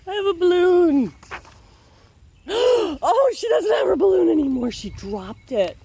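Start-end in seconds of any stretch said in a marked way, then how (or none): 0.1-1.1 put-on much higher, louder child voice; little girl voice. 2.5-4.7 gasp, then higher, faster and louder; real shock at the page; surprised gasp. Real, not acted. Reacting to the picture.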